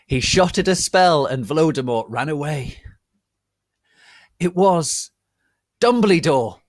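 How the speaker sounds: loud, high, building to the name; dramatic reveal. A narrator's reading. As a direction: announce the hero's arrival with a flourish.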